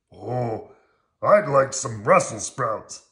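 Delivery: loud, slow monster voice; heavy, drawn-out and loud; Harry. A narrator's reading. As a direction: order slowly in a big puppet-monster voice. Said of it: "said Harry".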